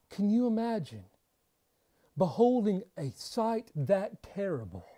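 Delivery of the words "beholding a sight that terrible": ordinary delivery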